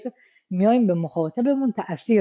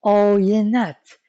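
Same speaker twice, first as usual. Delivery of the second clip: آینت said very slowly, stretched, falling at the end; drawn-out word; 2 semitones higher, 3 dB louder, much slower than usual. Shown as a demonstration. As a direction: draw out آینت slowly so every sound is heard. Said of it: "کلمهٔ آینت شما یه نگاهی بهش بندازین".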